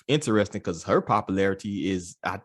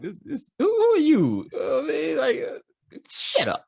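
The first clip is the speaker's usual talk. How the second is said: much higher, squawky mock indignation; mock outraged woman; 4 dB louder than usual. Co-host imitating her angry reaction to a question, mock outraged. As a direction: send her up in a high, affronted voice. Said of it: "But it's like her, it's like".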